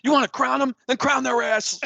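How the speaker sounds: very high, loud coach rant; Denny Green impression. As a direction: yell it like an outraged coach at the podium. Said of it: "Denny Green".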